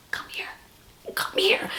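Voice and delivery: urgent hushed whisper, rising at the end; kid's whisper. Retold or imitated. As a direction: whisper insistently like a child. Kid whispering again.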